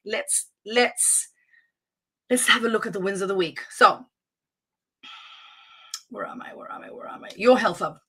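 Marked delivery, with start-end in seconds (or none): 6.1-7.3 very soft, whispered searching; muttering to self. Real, not acted. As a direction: whisper it to yourself while finding your place. Searching for her place in list.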